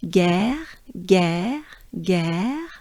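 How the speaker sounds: "guerre" with a French R, rising at the end. Shown as a demonstration. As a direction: draw out "guerre" as a demo, lifting the pitch. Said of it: "that's the way the French R sounds".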